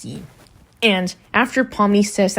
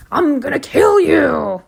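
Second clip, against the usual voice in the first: high, loud, drawn-out cartoon threat; imitated angry Zoobal; 9 semitones higher, 5 dB louder than usual. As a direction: stretch out a mock-furious yell, high and slow. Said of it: "where Zoobal gets angry".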